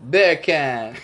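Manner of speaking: beer can as bacon, a lightly done Australian accent. Shown as a demonstration. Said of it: "Say beer can in an Australian accent".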